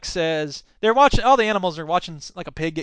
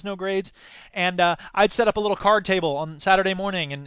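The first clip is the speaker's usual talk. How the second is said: ordinary delivery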